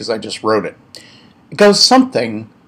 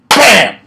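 sudden loud punchy bam; loud sound effect; 10 semitones higher, 10 dB louder than usual. Acted. Joke teller punching the heart attack moment, loud bam. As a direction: slam the impact out high and loud.

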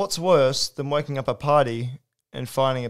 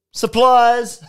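shouted punchline; 11 semitones higher, 8 dB louder than usual. Acted.

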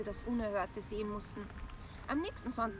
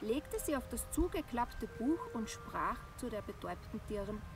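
ordinary delivery

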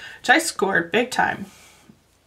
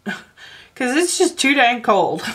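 laughing; 6 semitones higher, 3 dB louder, slower than usual. Real, not acted. Laughing about the cold winter.

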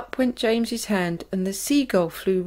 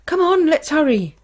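loud, higher urgent call; urgent hurry; 8 semitones higher, 6 dB louder than usual. A narrator's reading. Crew urges each other into the tunnel, loud and higher. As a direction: hurry everyone along.

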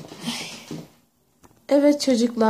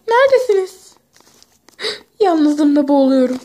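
dramatic call; 4 semitones higher, 3 dB louder than usual. Acted. Calling out for friends, dramatic.

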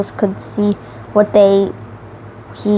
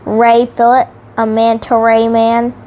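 plain reading voice

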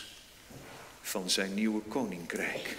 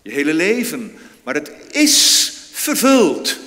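proclaiming; 5 semitones higher, 12 dB louder than usual. Acted. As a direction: ring it out as a climax. Sermon climax, proclaiming fulfilment.

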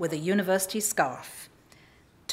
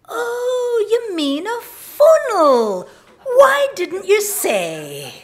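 delighted shopkeeper; 12 semitones higher, 9 dB louder, much slower than usual. Retold or imitated. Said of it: "and she said".

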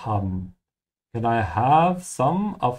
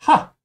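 mock triumphant laugh; 8 dB louder than usual. Acting the character's laugh.

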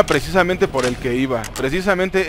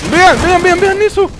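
high, loud excited call; excited shout; 14 semitones higher, 7 dB louder than usual. Real, not acted. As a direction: yell at them to look.

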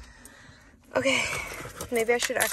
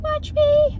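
very high, loud comeback; mock defiant yell; 7 dB louder than usual. Imitating someone in a public fight, mock defiant. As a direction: fling it back in a put-on high voice. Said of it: "They'll just come and yell at you".

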